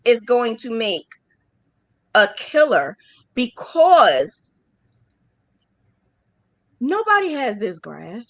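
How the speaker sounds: ordinary talk, nothing marked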